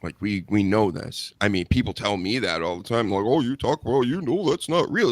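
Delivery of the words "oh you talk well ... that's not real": higher, sing-song mock of naysayers; mocking imitation. Wrestling host mimics people saying it's fake, mocking. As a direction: mockingly parrot the know-it-alls.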